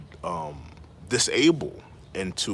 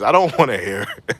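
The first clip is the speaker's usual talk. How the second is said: louder, laughing through it; laughing dismissal; 7 dB louder than usual. Real, not acted. Streamer laughing off the complaints, loud. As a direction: brush it off while laughing.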